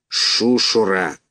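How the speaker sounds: slow, drawn-out hissing goose; slow menacing goose hiss. A narrator's reading. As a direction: hiss the word out like an angry gander. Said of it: "шипит гусак".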